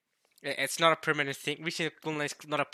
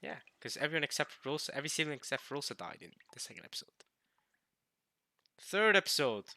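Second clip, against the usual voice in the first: ordinary talk, nothing marked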